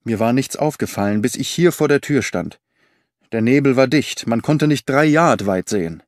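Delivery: plain reading voice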